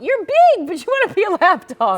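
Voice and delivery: high, loud doggy-talk voice; pet talk. Retold or imitated. Host teasing a big collie, playful pet talk. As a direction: tease a big dog in baby talk. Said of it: "I keep going".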